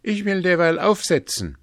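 loud, high-pitched offer; calling out. A narrator's reading. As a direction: call it out briskly to the players. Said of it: "rief er".

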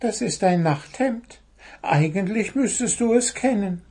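high, innocent bird voice; high-pitched storybook bird; little bird explaining. A narrator's reading. Cheeky bird explains to angry gnome.

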